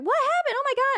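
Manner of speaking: high, loud panicky cry; a mom who can't see what happened; imitating a frantic mom; freaking out. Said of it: "freaking out".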